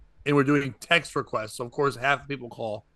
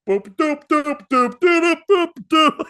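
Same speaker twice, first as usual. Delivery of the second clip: rising, louder and higher beeps; imitated phone ring; 12 semitones higher, 7 dB louder, slower than usual. Comic mimicking the heckler's ringing phone, rising beeps. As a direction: mimic an old ringtone going off.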